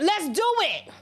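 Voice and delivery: high quick challenge, let's do it; defiant dare. Retold or imitated. Urging viewers to meet a threat with a high defiant dare. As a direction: snap it back as a dare. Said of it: "You was supposed to be like".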